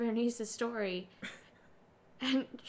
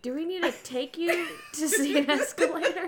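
laughing retelling; 5 semitones higher than usual. Retold or imitated. Retelling friend's question while laughing.

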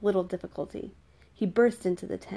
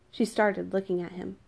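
ordinary delivery

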